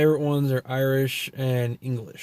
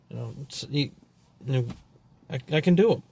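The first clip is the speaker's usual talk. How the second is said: ordinary talk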